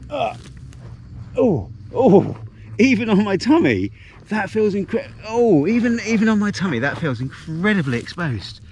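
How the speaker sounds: high, breathless exclamations while lying over a drop; real jittery awe lying on the tummy at the edge; real vertigo. Real, not acted. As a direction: gasp it out, nervous at the cliff edge. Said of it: "serious amount of vertigo".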